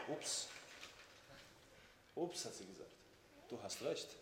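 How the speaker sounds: soft, low concession; sheepish admission. Retold or imitated. Wife conceding the point to her husband, sheepish. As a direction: give in quietly.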